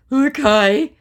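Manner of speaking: high, loud rising okay; mock scammer okay. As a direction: chirp it as him. Voicing scammer.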